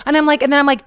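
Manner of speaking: ordinary delivery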